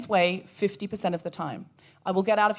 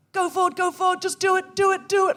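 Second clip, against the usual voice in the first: high, pumped-up soldier chant; Platoon war cry; 9 semitones higher than usual. Retold or imitated. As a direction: psych yourself up like in a war film. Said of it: "like I was in Platoon".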